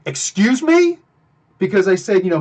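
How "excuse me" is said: confrontational retort. Retold or imitated. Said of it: "And he says".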